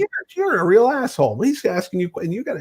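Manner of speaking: high-pitched imitation of indignation; mock scolding. Wife telling off husband's idol, mock scolding. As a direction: scold in a high voice without shouting. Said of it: "So she's yelling at him".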